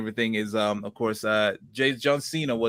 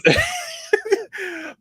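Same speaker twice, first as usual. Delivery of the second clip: burst of laughter; 3 dB louder than usual. Real, not acted. Laughs after apologizing for accent.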